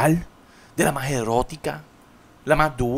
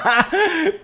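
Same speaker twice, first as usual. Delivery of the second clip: high laugh; 5 dB louder than usual. Real, not acted. Laughs at own joke.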